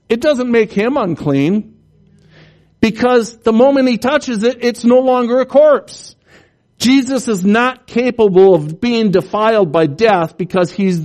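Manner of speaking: rising fervent sermon climax; preaching at full force. Real, not acted. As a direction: drive it home from the pulpit.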